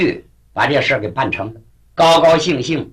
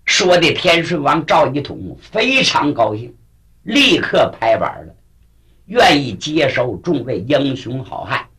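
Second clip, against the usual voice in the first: plain reading voice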